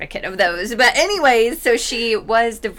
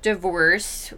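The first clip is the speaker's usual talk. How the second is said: ordinary talk, nothing marked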